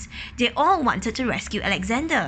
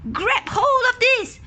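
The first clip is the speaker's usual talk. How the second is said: high, slow shouted order; policeman shouting; 4 dB louder than usual. Acted. Policeman shouting down the hole to the duck, high and slow. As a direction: call the order down the hole slowly. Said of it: "he shouted".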